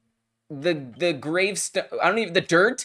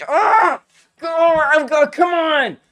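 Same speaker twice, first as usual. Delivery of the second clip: high, loud exasperated yell; frustrated outburst; 10 semitones higher, 8 dB louder, slower than usual. Real, not acted. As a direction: shout at the game in despair. Outburst at losing in game.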